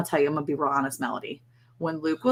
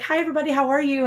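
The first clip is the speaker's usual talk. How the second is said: cheery hello; 8 semitones higher, faster than usual. Retold or imitated. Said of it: "I was like".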